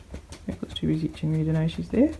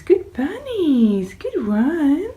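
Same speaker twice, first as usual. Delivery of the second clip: high, louder, slow drawn-out praise; pet praise; 10 semitones higher, 5 dB louder, much slower than usual. Real, not acted. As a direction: stretch the praise out high for the bunnies. Praising rabbits.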